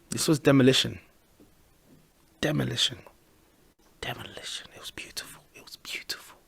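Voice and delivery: savouring it, sinking from soft to whispered; relishing the win in a whisper; fading to a whisper. Real, not acted. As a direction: let it drop to a hushed whisper.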